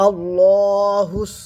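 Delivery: আল্লাহুস sung slow, the hu joined to the s; slow Quranic recitation. Shown as a demonstration. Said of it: "হা সদের সাথে যুক্ত হচ্ছে".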